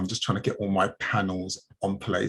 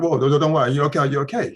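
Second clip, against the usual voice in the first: loud, higher-pitched brush-off from a driver; hurried mock reassurance, pitched up; driver's funny energy; 5 semitones higher, 7 dB louder than usual. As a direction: imitate a flustered driver, raised voice. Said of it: "He's got this funny energy going on".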